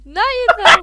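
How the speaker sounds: starting to crack up; words giving way to laughter; laughing. Real, not acted. Breaks into laughter.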